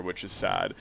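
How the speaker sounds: ordinary delivery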